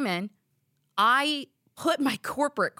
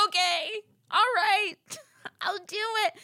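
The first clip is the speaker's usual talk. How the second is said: forced cheerful okay; 11 semitones higher than usual. Retold or imitated. Fed-up reply at post office.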